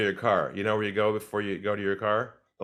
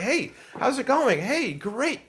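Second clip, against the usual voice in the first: much higher, bright mock salesman hello; hyped-up greeting; 13 semitones higher, 4 dB louder, slower than usual. Salesman imitating an overeager rep's greeting, much higher. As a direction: overeager rep bursting in. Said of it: "We're all hyped up".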